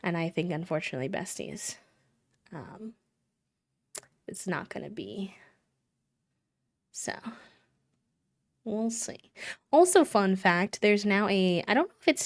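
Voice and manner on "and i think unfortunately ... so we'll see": hushed whisper, unvoiced. Real, not acted. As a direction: whisper the bad news gently. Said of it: "whispered bad news to chat".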